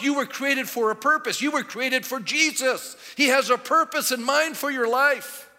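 loud, high-pitched sermon delivery; preaching. Acted. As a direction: preach it out at full force. Preaches at full force.

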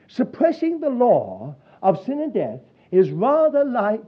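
ordinary delivery

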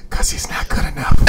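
giggling whispered putdown; small-caps whisper. Pretend. Coach answering yelling parent in a tiny whisper. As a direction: whisper it like tiny font, laughing. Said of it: "You should have responded in all small caps, in a smaller font".

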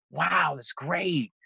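imitating an amazed, relieved client; retold amazement. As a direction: marvel slowly, stretching each word. Retold relief after a breakthrough.

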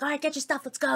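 brisk command. Retold or imitated. Mom showing up to collect her child, brisk command.

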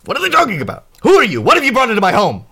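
high, loud, agitated questioning; indignant demand. Acted. Quint turns on Fads in the home, indignant demand. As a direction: fire off alarmed questions at the intruder.

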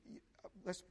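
ordinary talk

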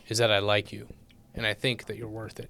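soft, low-key mock heart-to-heart; fatherly speech. Host gives guest the speech his dad never gave, tender. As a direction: tell him softly, like a proud dad. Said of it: "the speech that your dad never gave you".

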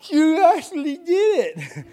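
high, slow, laughing disbelief; astonished kid. Retold or imitated. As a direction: imitate the soaked boy's shock. Son's astonished reaction, retold.

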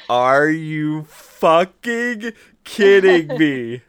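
loud, drawn-out mock outrage; retold outrage. Customer at the window after waiting in line, loudly outraged. Acted outrage at missing meatloaf.

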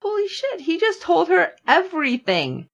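high-pitched amazed reaction; mock astonishment, pitched well up; shocked realization. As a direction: replay the jaw-drop moment. Acting out own shocked reaction.

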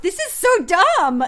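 high, loud, slow complaint; animated mock despair, punched up; retold exasperation. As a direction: draw it out in exasperated disbelief. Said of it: "I turned to you and I mouthed".

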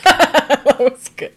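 ordinary talk

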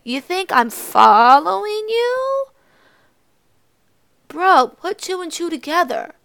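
loud, high, drawn-out indignant question; pissed off. Acted. Speaker to the kid who thinks she followed him, pissed off. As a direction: throw it at him in disbelief. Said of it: "I was kinda pissed off".

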